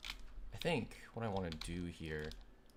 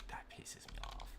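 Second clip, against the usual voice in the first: whispered grumble, the second slower; annoyed whisper; 6 dB quieter, slower than usual. Real, not acted. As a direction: hiss your irritation under your breath. Annoyed muttering at the game.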